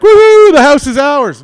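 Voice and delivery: very high, very loud cheer; mock triumphant whoop. Siblings celebrating getting the house, loud high mock whoop. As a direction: whoop it up like a gleeful winner.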